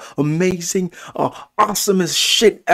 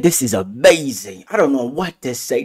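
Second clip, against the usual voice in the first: ordinary talk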